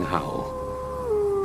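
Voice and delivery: long stretched howling pitch; howled words. A narrator's reading. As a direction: stretch it out like a wolf howl. Wolf howl described by narrator.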